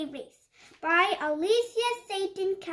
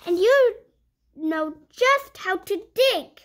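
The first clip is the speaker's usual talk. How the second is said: lively picture-book read-aloud; reading to children; 5 dB louder than usual. Acted. Reading a puppy picture book to young viewers, bright storytime voice. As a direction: read it like storytime for little kids.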